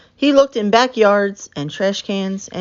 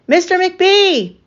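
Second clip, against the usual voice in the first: high, slow drawn-out call; calling louder; 11 semitones higher, 3 dB louder, much slower than usual. A narrator's reading. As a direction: call down the hall for help. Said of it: "He could not hear her calling".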